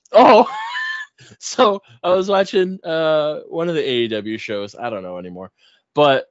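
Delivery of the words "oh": excited outburst. Real, not acted. Sudden remembering, laughing.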